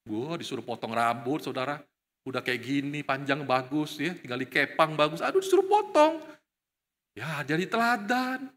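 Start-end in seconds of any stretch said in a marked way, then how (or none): 5.2-6.2 much higher, whiny "Aduh"; mock whine. Young man sulking about the haircut order, in a high whine. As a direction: groan like a sulky youth.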